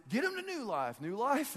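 high-pitched cheerleading chant; exaggerated rah-rah cheer; mock rallying cry. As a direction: hype it up like a pep rally, mockingly. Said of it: "I'm not saying".

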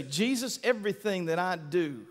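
low, soft sincere prayer; a believer praying to Jesus; quiet prayer. Retold or imitated. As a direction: pray it softly and earnestly. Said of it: "when you say".

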